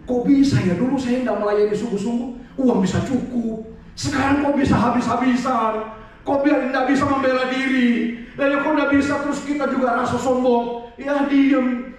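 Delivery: high, insistent mock whine; hammed-up aggrieved wail; wailing complaint. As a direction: lament like a hard-done-by church worker. Acts aggrieved volunteer complaining.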